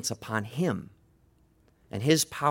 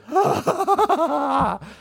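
high, loud acted nervous laugh; nervous chuckle; 6 dB louder than usual. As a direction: let out a put-on laugh of relief. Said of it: "kind of this nervous chuckle".

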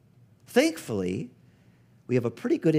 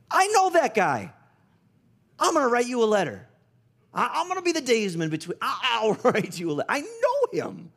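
higher, louder, eager voice, laughing a little; Paul leaps at the chance to help, much higher; excited Paul; 12 semitones higher, 4 dB louder than usual. Acted. Paul excitedly offers to write letter.

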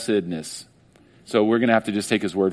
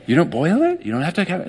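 mild surprise; 6 semitones higher than usual. Acted. People in awe of hot water.

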